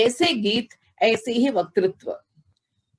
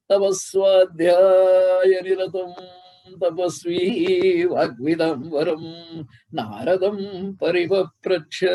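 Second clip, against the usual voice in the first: shloka recitation; 3 semitones lower, slower than usual. Acted. Guru recites Valmiki's opening verse to the audience, intoned slowly. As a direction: chant the verse slowly and gravely.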